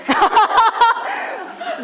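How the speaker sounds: loud high burst of laughter. Real, not acted. Teacher laughing at a student's answer, high laugh. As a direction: laugh freely with the class.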